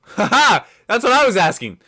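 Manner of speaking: loud laugh, high pitched. Real, not acted. Laughing at the clip.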